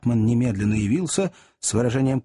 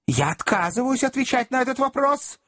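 high-pitched, affronted firmness; offended, voice pitched well up; indignant refusal; 12 semitones higher than usual. A narrator's reading. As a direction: refuse as if bristling at an order. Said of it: "приходя в возмущение от внезапного перехода".

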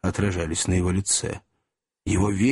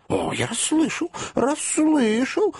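eager protest; 13 semitones higher, slower than usual. Acted. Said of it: "возразил мистер Тапман".

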